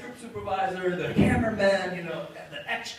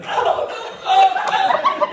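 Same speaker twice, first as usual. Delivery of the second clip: high, loud comic moaning, climbing higher; hammed-up moans; 11 dB louder, much slower than usual. Neelix moaning as the camera closes in. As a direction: overplay a squealing moan.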